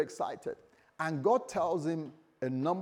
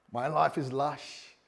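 slow, drawn-out Bristolian impression; Bristolian accent; slower than usual. Preacher trying on an accent for church, slow Bristolian. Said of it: "give me a Bristolian accent".

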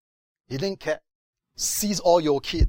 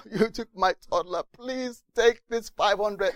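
pleading; 7 semitones higher than usual. Retold or imitated. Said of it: "you're, like, pleading".

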